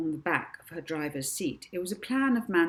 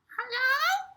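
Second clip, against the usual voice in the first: much higher squeaky woman on an entry phone; squeaky woman's voice. A narrator's reading. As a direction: squeak it out like a tiny receptionist. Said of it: "said a squeaky woman's voice down the entry phone".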